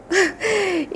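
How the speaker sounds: high, soft nervous giggle; nervous laugh. Retold or imitated. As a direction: let out a small stunned laugh. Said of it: "me daba así como". (risita nerviosa, aguda y suave)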